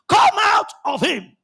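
loud, high, drawn-out command; shouted command. Retold or imitated. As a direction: order the spirit out with a shout. Jesus commands an evil spirit.

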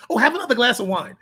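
quick, breezy, offhand tease; just like that. Shown as a demonstration. Trainer showing how he teased the client about wine, quick and offhand. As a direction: toss it off lightly as a jab. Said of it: "I said it just like that".

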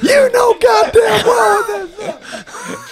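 bellowing, high and loud, slow; furious drill-sergeant yell; angry shout. Retold or imitated. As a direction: roar it like an enraged officer. General yelling at soldier.

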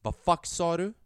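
loud, high shout like a real gangster; gangster yell. Retold or imitated. Boy shouting back at the leader like a gangster, loud. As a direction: yell it out so the whole crowd hears. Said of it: "jag bara skriker ut så, liksom som en, en riktig gangster".

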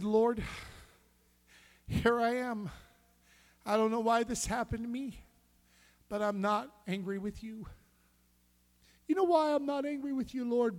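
high, loud preaching cry to the Lord; fervent prayer. Acted. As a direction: pour it out to God at full force. Preaching prayer at full force.

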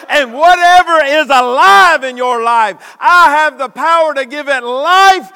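loud, high, rising sermon climax; preaching at full force. Acted. Preacher to his church, full-force shouting. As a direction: shout the promise out to the congregation.

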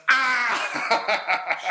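high laughing shout; dad roaring with laughter at the fallen goat; mock roar of laughter. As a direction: let out a big laughing roar. Dad's roar of laughter imitated.

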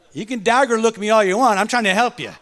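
usual speaking voice

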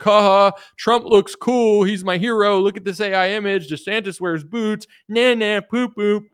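high, loud dopey mock voice; lightly voiced goofy troll; mocking meme poster. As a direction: parody a childish fanboy. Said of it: "It's like".

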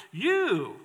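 YOU said high and pointed; emphatic You. Shown as a demonstration. Preacher to disciples, emphatic on "You". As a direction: land hard on "You" as a direct address. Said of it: "It's emphatic".

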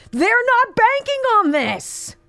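high, emphatic declaration; rally the audience, stress on not; fired up. Real, not acted. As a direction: lift it, punching not. Fired-up rant to supporters.

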